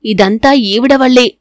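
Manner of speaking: ordinary delivery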